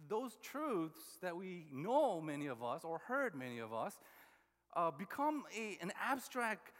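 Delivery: usual speaking voice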